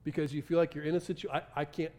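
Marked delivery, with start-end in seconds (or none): none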